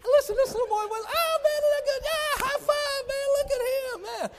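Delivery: very high, loud, hammed-up showman voice; hamming it up for the coffee line, very high and loud; clowning showman. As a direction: play the crowd like a carnival barker. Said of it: "the clown of me".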